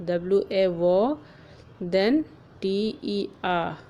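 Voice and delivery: slow, splitting the word into syllables; sounding out syllables. Shown as a demonstration. Teacher sounding out water's syllables, slow and deliberate. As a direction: break water into its two parts slowly.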